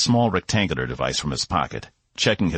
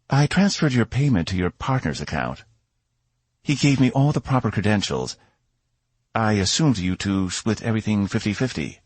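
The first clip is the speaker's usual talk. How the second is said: a hint of a higher, confident royal lady; lightly voiced princess; 5 semitones higher than usual. A narrator's reading. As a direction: play the self-assured princess, lightly.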